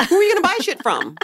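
fast, squeaky disbelief; shrill it out in disbelief; high incredulous. Real, not acted. Incredulous joke.

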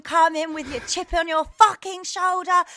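mock furious telling-off; angry rant. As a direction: tear into him. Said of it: "I went absolutely mental".

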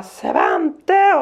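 high, drawn-out mock yell; retold yelling. Mum searching the park for her son, retold yelling. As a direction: imitate hollering a child's name. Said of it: "står och skriker så här i parken".

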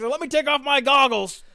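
loud, high put-on voice; Frank character voice. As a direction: play him big and loud. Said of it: "Frank's like".

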